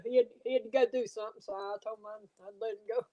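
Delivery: usual speaking voice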